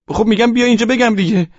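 high-pitched exasperated pleading; impatient insistence. Acted. Son urges mother on phone to come, impatient insistence.

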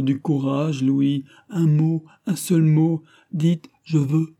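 desperate plea, slowing at the end; impassioned Marie, pleading. A narrator's reading. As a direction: beg him to find his courage.

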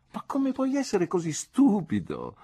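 put-on high young woman's voice, playfully scolding; Dora scolding. A narrator's reading. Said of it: "rispose Dora picchiandomi la mano". (voce alta e infantile da ragazza, rimprovero scherzoso)

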